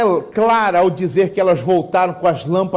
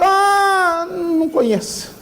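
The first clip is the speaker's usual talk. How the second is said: dismissive refusal; 9 semitones higher, slower than usual. Acted. Homeowner refuses stranger.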